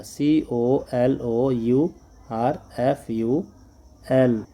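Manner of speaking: letters spelled slowly one by one; letters dictated to class; slow spelling. Shown as a demonstration.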